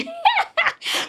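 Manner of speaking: high-pitched burst of laughter; giggle. Acted. Singer tells friend about getting the microphone, giggling.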